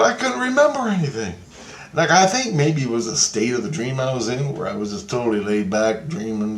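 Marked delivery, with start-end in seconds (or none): 0.0-1.3 pleading protest. Retold or imitated. Repeats plea to Christ.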